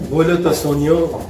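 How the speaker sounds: lovingly. Retold or imitated. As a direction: coax her tenderly. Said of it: "प्यार से पूछता है".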